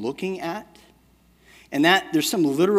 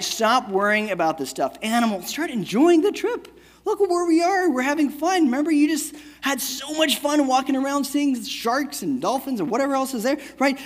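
higher, bright lecturing dad voice; 8 semitones higher than usual. Retold or imitated. Father mock-lecturing his crying daughter in the aquarium, hammed-up dad voice. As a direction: preach it like a know-it-all dad. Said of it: "typical dad, boring lesson stuff".

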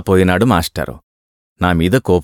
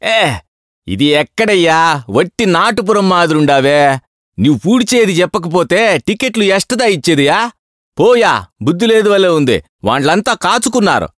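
higher, scolding Tamil-tinged yell; shouting clerk; 7 semitones higher than usual. A narrator's reading. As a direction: bark at the fool holding up the queue. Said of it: "అని కేకలేశాడు".

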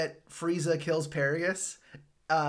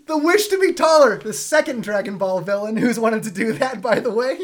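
excited; 6 semitones higher, 11 dB louder than usual. Real, not acted. Excited aside on villains.

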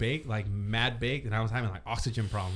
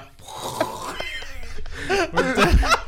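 high laughing shout; howling laugh. Real, not acted. Laughing hard at story.

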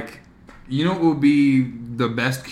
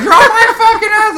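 high, loud mock yell; imitating angry driver; 6 dB louder than usual. Imitating the other driver's complaint, high mock-angry yell. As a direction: squawk it like an irate driver. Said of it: "and she's like".

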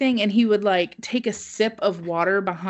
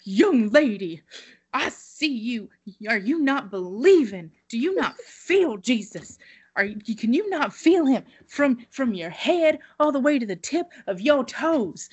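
higher, pleading mock preacher; single out the girl like a fervent preacher; preacher calling out; 4 semitones higher than usual. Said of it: "he's like".

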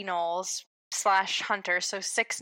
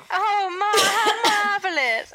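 mock delight; 11 semitones higher, 8 dB louder, slower than usual. Gloating at the hit.

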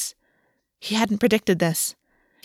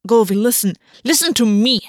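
sharp, louder demand; snapped; 3 semitones higher, 5 dB louder than usual. Acted. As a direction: cut in hard and louder. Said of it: "Golvi snapped".

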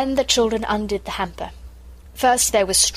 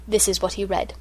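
ordinary delivery, nothing marked

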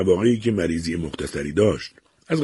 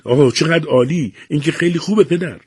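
higher, louder burst of joy; happy cry; 8 semitones higher, 4 dB louder than usual. A narrator's reading. Daughter hears the doctor found nothing wrong, crying out happily. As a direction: cry out with delight. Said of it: "با خوشحالی فریاد کشید".

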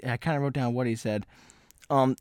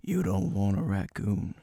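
slow, low mock hillbilly voice; imitating a country drawl; 5 semitones lower, 6 dB quieter than usual. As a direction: lower and slower, a lightly drawled warning. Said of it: "He's talking like this".

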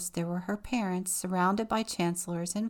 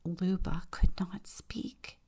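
ordinary delivery, nothing marked

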